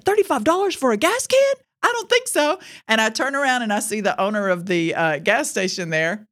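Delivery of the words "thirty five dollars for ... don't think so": high, louder, outraged voice; outraged customer hearing the price; indignant disbelief. Retold or imitated. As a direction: balk at the price, scandalized.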